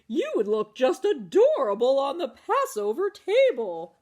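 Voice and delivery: loud gushing auntie voice; doting auntie. Acted. Auntie Bertha gushing to the matzah man about the table. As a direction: gush over how cute he is.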